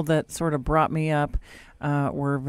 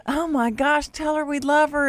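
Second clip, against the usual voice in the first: high-pitched retold urging; raised, excited mom voice; urgent mom; 10 semitones higher than usual. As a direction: imitate an overwhelmed mother urging her child. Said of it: "And she goes".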